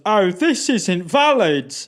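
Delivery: high, loud, drawn-out officious mimicry; fussy official. British border officer rejecting passport, fussy. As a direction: imitate a jobsworth rejecting a document.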